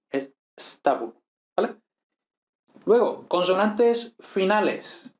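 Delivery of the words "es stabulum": stabulum starting straight on S; no E before stabulum; correct S start. Shown as a demonstration. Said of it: "no es estabulum".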